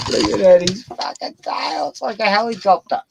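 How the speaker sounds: high, excited squeal at the dog's tail; delighted high-pitched dog talk. Real, not acted. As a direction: gush over the wagging tail. Excited about wagging tail.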